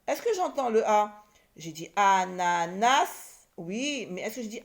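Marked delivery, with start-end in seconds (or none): 1.9-3.5 ANANAS slowly drawn out, last syllable rising. Shown as a demonstration. Teacher checks for the sound A, ANANAS said syllable by syllable. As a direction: stretch each A in ANANAS. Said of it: "dans la troisième syllabe".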